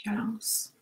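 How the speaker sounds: breathy whispered violence; whispered word. Acted. Leader whispers the word while tapping. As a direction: whisper it on the tap.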